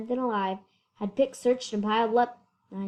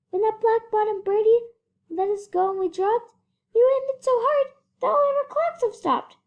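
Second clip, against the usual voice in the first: very high, loud pleading little voice; pleading mayor; 12 semitones higher, 5 dB louder than usual. A narrator's reading. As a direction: beg like a tiny worried mayor. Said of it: "pleaded the voice of the mayor".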